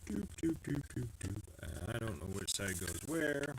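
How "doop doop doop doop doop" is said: humming doops. Acted.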